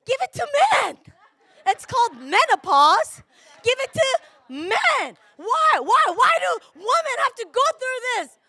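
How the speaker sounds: high, loud shouted complaint; angry woman yelling at God. Retold or imitated. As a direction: rail at God, why. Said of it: "I was so angry at God".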